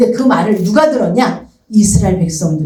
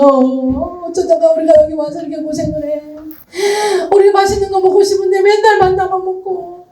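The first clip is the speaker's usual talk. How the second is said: much higher, weeping whine; crying complaint; 9 semitones higher than usual. Acted. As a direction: wail about the hardship through tears. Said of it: "그러면서 우는 거예요".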